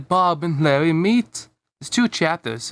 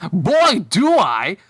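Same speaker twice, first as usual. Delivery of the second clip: put-on high, louder, delighted kid shout; happy exclamation; 5 semitones higher, 5 dB louder, much slower than usual. Bob tells Larry he loves silly songs, excitedly. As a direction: burst out with joy. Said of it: "Bob happily exclaimed".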